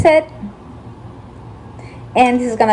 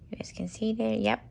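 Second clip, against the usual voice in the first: whispering; 13 dB quieter than usual. Real, not acted. Whispered aside at night.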